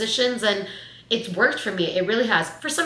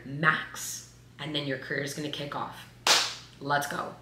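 low, soft murmur to herself; coaxing yourself through the shift door; quiet self pep talk; 5 semitones lower, 6 dB quieter than usual. Retold or imitated. To herself before clocking in, a soft pep talk.

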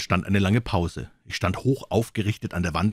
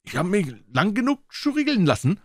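grumbling pirate, much higher; complaining call; 12 semitones higher than usual. Acted. Another pirate protests to Silver, fed up. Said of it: "rief ein anderer".

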